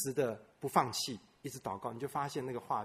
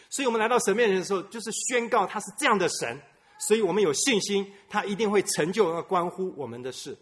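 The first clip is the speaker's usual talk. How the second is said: higher, louder preaching, pressing the point; preaching at full force; 7 semitones higher, 8 dB louder than usual. Acted. Pastor urging the church to call on God's name, fervent preaching. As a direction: proclaim it to the congregation.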